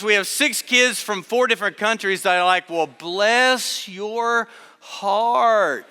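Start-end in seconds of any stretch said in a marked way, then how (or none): 2.7-5.9 very slow, drawn-out Southern "Well, bless your heart"; mock Southern sympathy, drawn way out; Southern drawl. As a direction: stretch it out like a Southern church lady. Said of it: "That's how they say it in the South".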